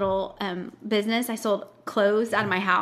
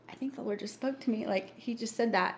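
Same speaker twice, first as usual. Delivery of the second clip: much softer, awed; hushed awe; 8 dB quieter than usual. Retold or imitated. Realizing the Lord spoke to her, hushed. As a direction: breathe it in wonder.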